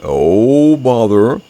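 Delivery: bothered storybook bear, loud first word; slow bear grumble; Pooh's voice. A narrator's reading. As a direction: draw it out in dismay. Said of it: "said Pooh".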